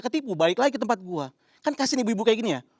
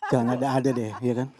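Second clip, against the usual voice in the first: ordinary talk, nothing marked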